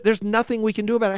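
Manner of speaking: ordinary talk, nothing marked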